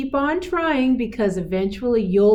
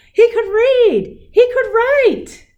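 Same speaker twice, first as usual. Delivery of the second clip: much higher, louder, slow and beaming; joyful triumph; 10 dB louder, slower than usual. A narrator's reading. Reading to kids, Leo finally blooms, high joyful announcement. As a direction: announce it like the best news ever.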